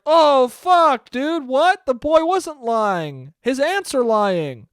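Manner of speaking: high, loud, slow shocked crowd; New York crowd realizing the aunts lied, high and loud. Retold or imitated. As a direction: gasp it out in disbelief. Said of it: "everyone's like".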